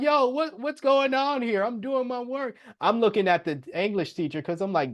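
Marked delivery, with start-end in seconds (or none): 0.0-2.6 high-pitched, acted-out protest; baffled protest. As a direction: protest in a much higher voice, caught off guard. Acted protest when ordered out of class.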